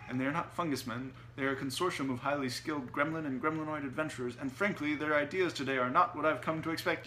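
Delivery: huffily defend, then berate, the gremlins; huffed. A narrator's reading. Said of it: "huffed Otto".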